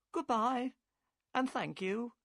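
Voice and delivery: the boatman's wife thanks Holmes; the wife's goodbye. A narrator's reading. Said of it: "said Mordecai Smith's wife".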